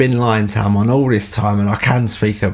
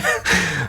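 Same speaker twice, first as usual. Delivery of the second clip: amused chuckle mid-story; short laugh; 6 semitones higher, 4 dB quieter than usual. Real, not acted. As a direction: chuckle lightly at your own tale. Laughs while telling the story.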